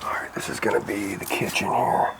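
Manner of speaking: hushed whisper. Real, not acted. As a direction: whisper it, unsure who's inside. Creeping through foreclosed house.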